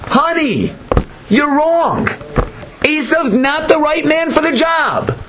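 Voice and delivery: high-pitched, impassioned retort; retold yelling back. Leah answering her husband Yaakov, yelling back in high pitch. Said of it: "She yelled back at him".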